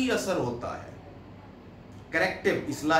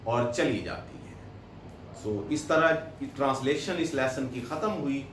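ordinary talk, nothing marked

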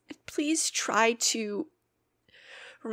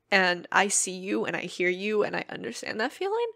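usual speaking voice

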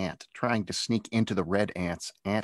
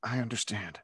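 much softer, almost swallowed; barely audible; 3 semitones higher, 6 dB quieter than usual. Acted. Zach submitting to the bully, so soft it barely comes out. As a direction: say it so he can hardly hear. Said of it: "So soft that he wasn't sure".

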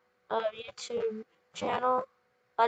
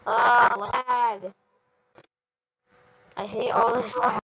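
frustrated; 5 dB louder than usual. Real, not acted. Game lagging, frustrated outburst.

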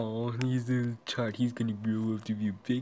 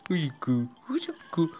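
squealing pet talk, rising higher; 8 semitones higher, 5 dB louder than usual. Real, not acted. Gamer cooing at her virtual pet in high baby talk.

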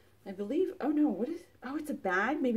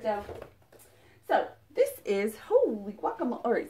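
ordinary delivery

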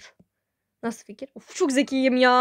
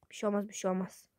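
ordinary talk

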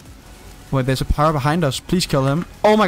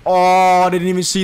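surprised; 3 semitones higher, 5 dB louder than usual. Real, not acted. Surprised by sniper.

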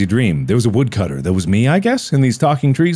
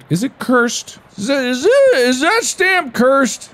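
mock suspicion. Real, not acted. Player suspects the stamp is cursed, high mock alarm. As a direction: squeak the question like it's haunted.

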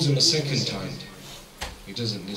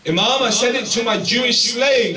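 emphatic loud repeat; 11 semitones higher, 10 dB louder than usual. Retold or imitated. Preacher repeating the companion's excuse, loud and emphatic. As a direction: hammer the excuse home with full force.

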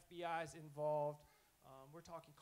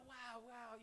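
playing dumb; 7 semitones higher than usual. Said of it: "I'm playing dumb".

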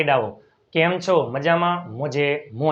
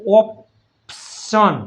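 drawn-out syllable by syllable; slow sounded-out word; much slower than usual. Shown as a demonstration. Teacher blending letters into a word slowly. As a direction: let the word stretch so kids hear each sound. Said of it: "ઓ પી કરી નાખો ભેગું".

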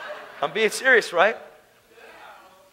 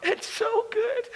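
real crying; 11 semitones higher, 4 dB quieter than usual. Real, not acted. Preacher overwhelmed by God's love before his congregation, breaking into tears. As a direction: voice breaking high into a tearful laugh. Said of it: "I'm having trouble holding it together".

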